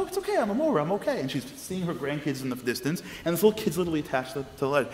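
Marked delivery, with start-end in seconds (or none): 0.0-1.2 high, bright-pitched breezy reassurance; cheerful reassurance. Retold or imitated. Grandmother reassuring the stressed mom, high and breezy. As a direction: wave off the fuss, much higher.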